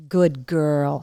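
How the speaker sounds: gentle praise to a pet; warm dog praise. Real, not acted. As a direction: tell the dog she did well.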